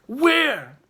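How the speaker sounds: loud, high furious shout; furious demand. A narrator's reading. Hungry chief shouts at the boy, furious. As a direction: roar the demand, rising with each word.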